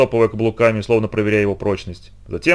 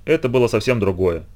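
ordinary delivery, nothing marked

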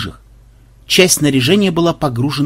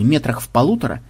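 usual speaking voice

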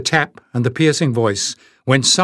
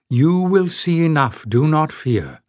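ordinary delivery

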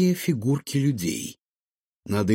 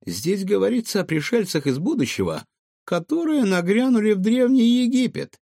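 very high little creature voice; high-pitched, wide-eyed explaining; small clever raccoon, amazed; 11 semitones higher than usual. Acted. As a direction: squeak out the decoded discovery.

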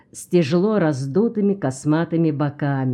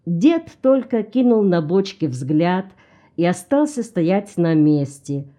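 ordinary delivery

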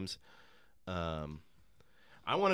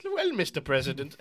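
put-on a light German accent; hint of a German scientist's accent; mild German-accented scientist addressing the president; 4 semitones higher than usual. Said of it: "a German accent".

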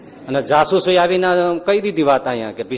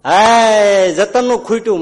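louder mock cry of lack; mock shout; 6 semitones higher, 8 dB louder, slower than usual. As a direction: call out in mock alarm. Maharaj mock shouts in story. (ઊંચા અવાજે નાટકીય બૂમ)

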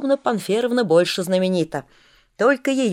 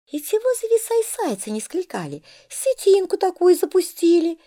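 lisping barber; 10 semitones higher than usual. A narrator's reading.

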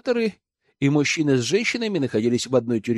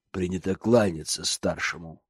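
gruff inmate; 8 semitones lower, 5 dB quieter than usual. Acted. Gruff inmate greets newcomer.

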